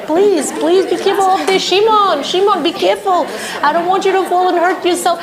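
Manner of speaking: mother praying over son on the chair; anxious praying mother. Retold or imitated. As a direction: pray frantically he won't fall. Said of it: "starts first of all to recite Tehillim".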